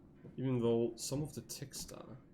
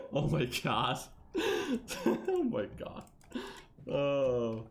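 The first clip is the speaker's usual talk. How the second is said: ordinary talk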